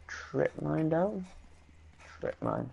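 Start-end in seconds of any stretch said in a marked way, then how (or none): 2.2-2.7 low, quiet repeat to himself; low mutter. Real, not acted. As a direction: mutter it under your breath, low.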